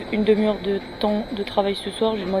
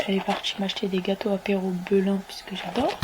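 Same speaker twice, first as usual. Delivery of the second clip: ordinary talk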